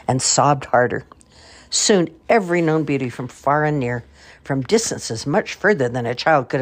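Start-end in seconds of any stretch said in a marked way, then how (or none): none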